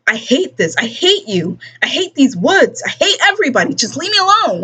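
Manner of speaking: put-on teen tantrum rising to a shriek; building tantrum. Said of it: "slithered out of the reach".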